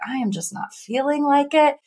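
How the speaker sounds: half-hearted groaning out of plans; mock reluctance. Retold reluctance on the day.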